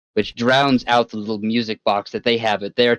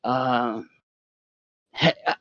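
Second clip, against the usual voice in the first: smooth con man; 3 semitones higher than usual. Acted. NPC boss voiced by GM.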